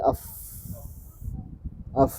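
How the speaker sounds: "af" ending on a held f; vowelless final f. Shown as a demonstration. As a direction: finish "af" on pure friction, no vowel. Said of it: "tidak ada bunyinya".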